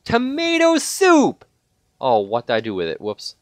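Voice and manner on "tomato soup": high, slow, sing-out exclamation; thrilled shout. Real, not acted. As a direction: cry it out like a glorious prize. Thrilled to find food.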